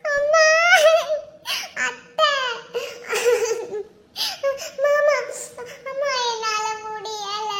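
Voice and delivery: tearful wailing calls to the family; crying in pain. Acted. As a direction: sob out each name as the pain hits. Pregnant wife crying in pain. (வலியில் அழுகையுடன் கூப்பிடுதல்)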